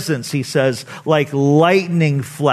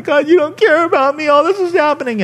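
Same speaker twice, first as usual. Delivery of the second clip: much higher, self-pitying mock wail; whiny complaint; 11 semitones higher, faster than usual. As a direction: imitate us griping at God. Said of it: "That's what we do in the midst of our storm".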